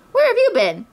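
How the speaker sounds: high-pitched, bouncy demand; high, eager storybook voice; excited greeting question. A narrator's reading. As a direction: ask it with exuberant surprise. Tigger bounces in on friends.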